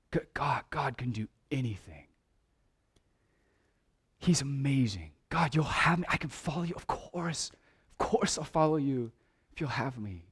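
soft, half-whispered wonder at God; hushed awe. Acted. Preacher voices childlike faith in God, hushed awe. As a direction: let it fall to a hush, as if awestruck.

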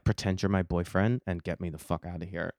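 low, softened urgent aside; retold secret demand, low and soft; covert plea. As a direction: beg a friend under your voice.

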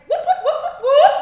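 very loud, high whoops; rapid string of high whoops; friend's whooping call. Retold or imitated. Friend Michelle calling out, loud high whoops. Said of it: "there's my friend Michelle".

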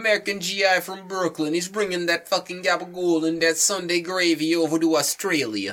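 high, hammy Brooklyn mobster voice; Brooklyn accent. Pretend. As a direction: ham up a Brooklyn Italian accent. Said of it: "even remotely close to a Brooklyn accent".

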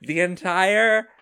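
high, loud, drawn-out enthusiasm; carried away, stretching the words out emphatically; excited. Real, not acted. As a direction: declare it big and slow, full of excitement. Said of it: "I'm getting so excited".

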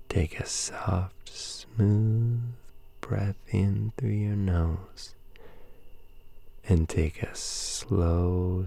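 slow, hushed breathing cues; calm meditative tone. Acted. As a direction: ease them gently into sleep.

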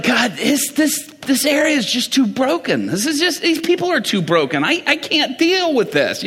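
high-pitched exasperated plea to God; fed-up prayer, voice lifted high; frustrated, upset. Retold or imitated. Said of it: "I'm frustrated, and I'm angry, and I'm upset".

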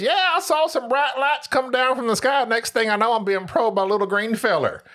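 high-pitched backwoods local telling his tale; hammed-up country eyewitness. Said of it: "in our neck of the woods is always the guy in the news".

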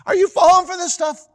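very high, loud disbelief; incredulous mock. Mocking the gullible, very high incredulous.

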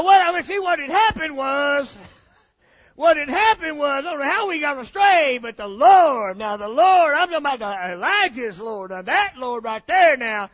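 loud, ringing preacher build; full-force preaching. Acted. Preacher declaring the Lord to the church, loud and ringing. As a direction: preach it hard to the congregation.

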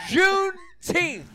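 high, loud, slow cry of the word Juneteenth; laughing shout. Real, not acted. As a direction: stretch Juneteenth out in a laughing yell. Shouted joke date.

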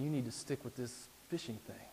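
hushed, coaxing tempter's voice; Satan the tempter. Acted. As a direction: tempt him softly, with long pauses. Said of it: "And Satan's lying to him".

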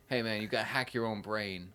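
a loose, casual hint of the rapper's delivery. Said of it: "Is that [name]".

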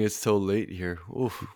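talking through a yawn. Real, not acted. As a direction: yawn while you talk. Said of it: "Sorry, I'm yawning".

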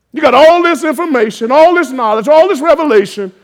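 loud, high preacher's shout; rising to a roar over the congregation; full-force preaching. Acted. Preacher to congregation, calling angels in a full-force shout.

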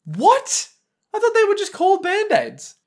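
squeaky high, loud disbelief; stunned, high-pitched outrage; shocked. Real, not acted. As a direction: voice leaps up in total shock. Shocked by Band-Aid news.

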